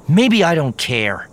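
loud, high, drawn-out retort; defiant retort. A narrator's reading. Mara shooting back at Luke's warning, defiant and angry. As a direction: throw it straight back, heated. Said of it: "she shot back".

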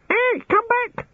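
very high parrot screech; screaming birds. A narrator's reading. Birds in the bushes echoing him, screaming. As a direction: screech it back like a mimicking bird. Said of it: "screamed the birds in the bushes".

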